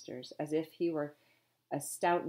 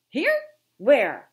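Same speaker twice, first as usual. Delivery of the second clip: loud demanding shout; 8 semitones higher, 14 dB louder than usual. A narrator's reading. Captain searches angrily.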